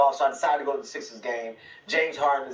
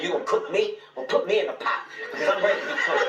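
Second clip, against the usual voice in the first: higher, quick cocky taunt with a laugh; mock bravado; 6 semitones higher than usual. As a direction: dare him, grinning. Taunting James Harden at game.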